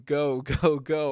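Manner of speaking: mock screaming, kept in check; imitated scream. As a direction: imitate a quarterback yelling to his receiver. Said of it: "he's screaming".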